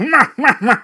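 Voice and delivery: high, loud cackling villain laugh; evil villain laugh. Retold or imitated. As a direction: laugh away like a cartoon baddie. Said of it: "And then just laughs away".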